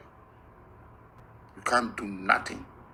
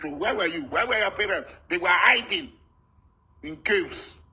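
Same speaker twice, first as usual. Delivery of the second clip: angry shout; 6 semitones higher, 4 dB louder than usual. Real, not acted. Shouting at critics who question his Igbo identity, loud and angry. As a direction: shout it out in accusation. Accusing opponents' parents.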